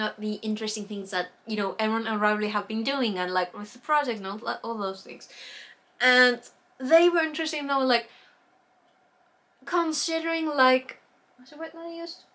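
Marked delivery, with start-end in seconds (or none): none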